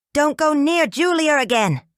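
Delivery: high, loud threatening order; threat. Acted. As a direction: menace him openly. Ex-husband threatening Roger.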